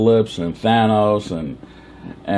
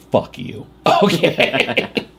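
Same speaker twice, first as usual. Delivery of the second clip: ordinary talk, nothing marked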